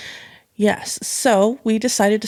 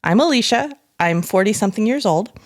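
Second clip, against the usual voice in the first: normal talking voice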